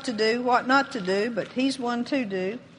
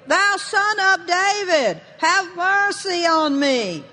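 high, slow, insistent cry; crying out all the more; 6 semitones higher, 3 dB louder, slower than usual. Acted. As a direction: plead loudly, stretching each word. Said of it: "he cried all the more a great deal".

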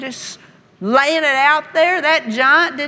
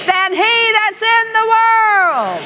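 preaching; 8 semitones higher, slower than usual. Acted. Preaching scripture loudly.

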